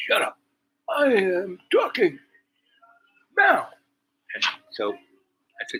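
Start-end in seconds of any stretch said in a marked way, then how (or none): 0.1-3.7 high, loud, slowly spaced-out mock command; mimicked outburst. Woman to the man interjecting, mimicked indignant outburst. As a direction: caricature someone indignantly silencing an interrupter, each word drawn out. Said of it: "she just went off".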